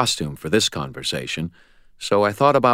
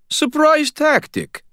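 countering; 6 dB louder, slower than usual. A narrator's reading. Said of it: "Sonya countered".

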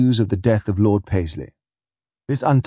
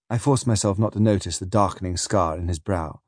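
plain reading voice

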